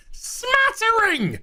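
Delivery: very high, slow squeal on smattering; high, drawn-out mock shriek; squeaked word. Real, not acted. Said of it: "a smattering of believability".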